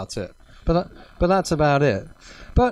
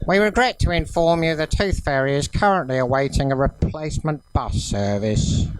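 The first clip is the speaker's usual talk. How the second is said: rail service announcer. Acted. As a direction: read it out like a station announcer. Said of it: "being Southern Rail".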